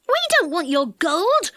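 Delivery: high, protesting cry; indignant cry. A narrator's reading. Dick denies wanting the gold, crying out. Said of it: "cried Dick".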